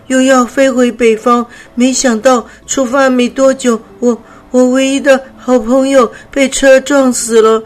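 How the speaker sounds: the weak butterfly telling her sad story, short of breath; panting while talking. Acted. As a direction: push the words out between breaths. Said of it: "灰灰边说边喘着气".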